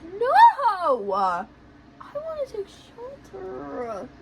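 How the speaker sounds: drawn-out whiny cry, high; mock wailing. Refusing to leave shelter, hammed-up wail. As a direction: drag it out like a tearful child.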